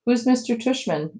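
put-on high, sleepy girl's voice; groggy. A narrator's reading. Bea has just woken in the car, groggy. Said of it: "Bea said groggily".

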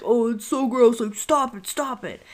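mock disgusted dad whining stop it; imitating dad. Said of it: "And he's like".

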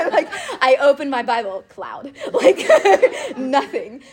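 high, breaking laughter through the words; laughing. Real, not acted. Telling friends her silent prayer, laughing. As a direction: laugh it out at your own expense.

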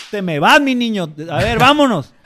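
put-on loud and high, sending the kid out; firm angry shout. A teacher finally yells at the bully to leave, loud and firm. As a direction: explode at him so he finally listens. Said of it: "Te tienes que aprender a enojar bien".

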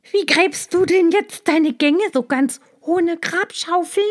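high, loud, taunting snake. Acted. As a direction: jeer at the digging mole. Snake taunts the toothy mole rat.

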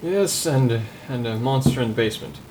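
normal talking voice

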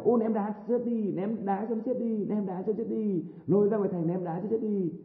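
high, slow, drawn-out mob jeer. Retold or imitated. Crowd demanding the woman be stoned, high slow jeering. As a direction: cry for her stoning like a bloodthirsty crowd. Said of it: "lại có nhiều kẻ".

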